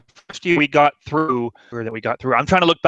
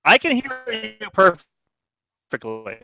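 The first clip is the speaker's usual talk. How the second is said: warbling, dragged-out broken connection; bad internet call, words warping and stretching; glitchy call audio; 7 semitones higher, slower than usual. Real, not acted. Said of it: "you're glitching out something fierce right now".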